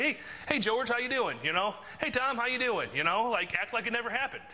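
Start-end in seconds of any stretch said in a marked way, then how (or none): 0.0-1.4 high, breezy hello with a lively lilt; cheery greeting. Acted. Greeting someone upset with him the next day, breezily cheerful. Greeting them as if nothing happened. 2.0-2.9 high, breezy hello with a lively lilt; cheery greeting. Acted. Greeting someone upset with him the next day, breezily cheerful. Greeting them as if nothing happened.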